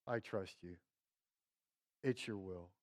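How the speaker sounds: lightly acted, low and soft surrender; understated resigned trust. Preacher voicing disappointed Martha to Jesus, quietly resigned. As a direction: drop low and quiet, as if giving in.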